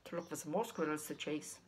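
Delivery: ordinary delivery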